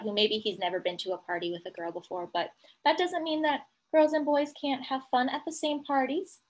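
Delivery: ordinary delivery, nothing marked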